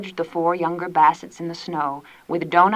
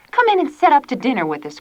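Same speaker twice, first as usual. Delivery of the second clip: higher, fast eager invitation; cried; 6 semitones higher, 3 dB louder, faster than usual. A narrator's reading. Tilly eagerly inviting Gad to dinner. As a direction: call him in warmly. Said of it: "cried Tilly".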